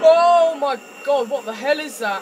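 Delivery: loud, high cry of real panic; frightened yell. Real, not acted. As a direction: yell out in sudden terror. Player jumps at horror game scare.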